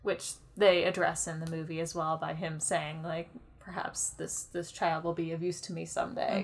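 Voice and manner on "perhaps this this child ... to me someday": lightly imitated villain, hushed; soft, sinister musing through a grin; a hint of scheming villain, kept low; creepy evil grin. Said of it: "with his creepy evil grin".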